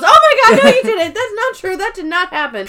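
high, loud, laughing panicked denial; flustered denial. Retold or imitated. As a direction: blurt it out high and frantic, half laughing. Angela flustered denial to Dwight.

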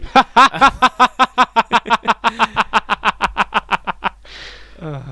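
long, loud, high cackle; rapid machine-gun burst of cackling; evil cackle. Real, not acted. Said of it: "listening to my evil cackle".

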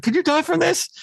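very high, anxious falsetto question; hammed-up high-pitched fretting; mock worried squeak. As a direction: imitate a timid man squeaking with worry. Voicing worried man before smoking.

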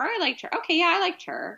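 high, breezy mock agreement. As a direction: squeak it out casually.

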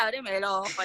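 casual imitation of Argentine speech, low-pitched; Argentine accent. Asking her cousin at home, imitated Argentine accent. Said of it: "yo le hablaba así".

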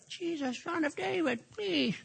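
hushed, pleading little prayer, high; hushed high plea; soft plea. Acted. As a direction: plead it quietly, almost under breath. Preacher reads a soft plea.

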